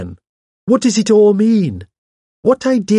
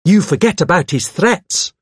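high, pressing reminder; eager; 8 semitones higher, 4 dB louder than usual. A narrator's reading. Hawes presses damning evidence on the vicar, eagerly. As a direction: push the point home keenly. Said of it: "said Hawes eagerly".

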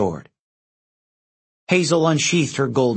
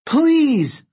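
high, pleading shout; crying out; 14 semitones higher, 4 dB louder than usual. A narrator's reading. Jason begs the crowd to stop, crying out. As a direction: cry out to plead with a mob. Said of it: "he cried".

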